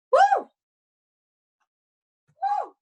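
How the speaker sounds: high, loud excited "woo"; excited whoop. Real, not acted. Host hyped by the crowd's energy, high excited whoop.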